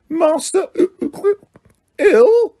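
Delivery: high, weepy elf voice rising to a wail; a drunk elf breaking into tears; Winky crying over her ill master; trembling elf cry. A narrator's reading. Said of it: "Her bottom lip began to tremble".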